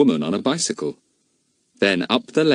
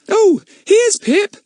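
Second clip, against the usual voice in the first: put-on little girl; 7 dB louder, slower than usual. A narrator's reading. Betts, little girl speaks.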